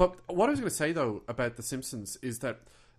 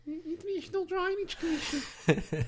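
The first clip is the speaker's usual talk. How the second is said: put-on high, slow Australian accent breaking into laughter; laughing through a mock Australian quote; slower than usual. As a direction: try the Australian voice and crack up. Imitating the show's Australian line.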